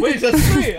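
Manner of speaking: laughing through the words; sheepish laugh after spitting coffee. Real, not acted. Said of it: "ça coule, hein, ton nez?".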